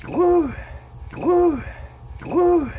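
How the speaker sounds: pained whoops. Real, not acted. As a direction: whoop through the burning heat. Mouth on fire from pepper.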